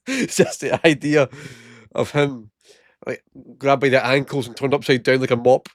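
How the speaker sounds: words through real laughter; giggling while picturing it; laughing. Real, not acted. Laughing at his own joke.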